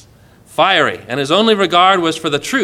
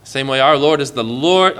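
normal talking voice

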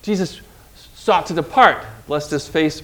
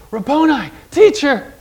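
higher, bright cry of recognition; an overjoyed higher "Rabboni"; joyful recognition; 8 semitones higher than usual. Acted. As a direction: burst out in joyful surprise. Mary recognizes Jesus with joy.

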